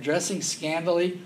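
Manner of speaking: usual speaking voice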